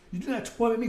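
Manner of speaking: fast re-reading of the plea; plain quick repeat of do not torment me; quick reread. Shown as a demonstration. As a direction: rattle it off again quickly. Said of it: "You better read it again".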